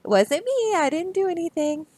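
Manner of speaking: high, faux-innocent humming Duck. Duck pretends innocence to the reader, high and sing-song. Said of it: "the duck is like".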